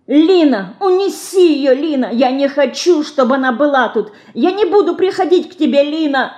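put-on very high child's shout; little girl clinging and screaming; crying out. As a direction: beg her to take it away. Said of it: "кричала мышка".

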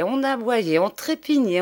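usual speaking voice

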